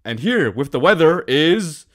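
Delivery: big TV-news introduction; mock news anchor. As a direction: announce the segment like a TV news host. Mock news host introducing segments.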